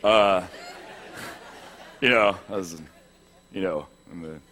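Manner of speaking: very low, soft mock-macho voice; put-on deep voice. Man recalling his first shave in sixth grade, put-on deep voice. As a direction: drop right down like a self-styled beast. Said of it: "not gonna talk like that anymore".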